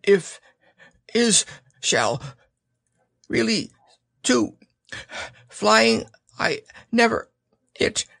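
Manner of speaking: high Piglet voice, jolted word by word; jerky thoughts. A narrator's reading. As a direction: gasp out single words between bounces. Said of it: "he thought jerkily to himself".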